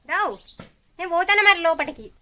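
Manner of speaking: loud, high-pitched summons; calling out. Real, not acted. Parent warning a child they will go inside, calling out.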